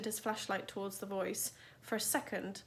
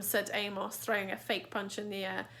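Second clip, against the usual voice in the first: usual speaking voice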